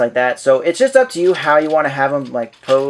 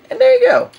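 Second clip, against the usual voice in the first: much higher, playful "there you go"; squeaky high voice; 7 dB louder than usual. Pretend.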